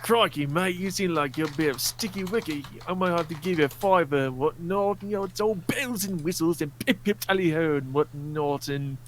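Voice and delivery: hammed-up British accent, drifting toward Australian; mock British accent. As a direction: play a cartoon British accent that slips into Australian. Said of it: "you sounded more Australian than British".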